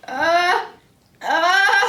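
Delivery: rising uh, uh, then a loud achoo; sneeze. Acted. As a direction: build up and explode into a big sneeze. Sneeze building again.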